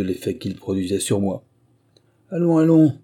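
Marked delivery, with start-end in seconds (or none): 2.3-2.9 urging call. A narrator's reading. Guide urging the hesitant narrator.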